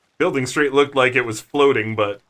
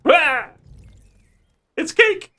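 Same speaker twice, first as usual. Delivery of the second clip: surprised; 14 semitones higher, slower than usual. Real, not acted. Sudden high surprised yelp.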